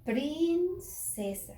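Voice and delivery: "princesa" very slow and high, first syllable held; blended sounds, stretched. Shown as a demonstration. Teacher shows P and R together, drawing out the word. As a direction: stretch "priiin" before finishing "princesa". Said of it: "Estas dos juntas suenan".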